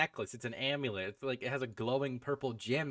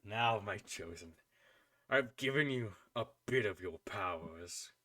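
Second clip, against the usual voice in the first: quiet, lowered voice of an unseen power; voice in the head; 4 semitones lower, 5 dB quieter than usual. Acted. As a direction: speak low and measured to your chosen one. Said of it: "as you hear the voice".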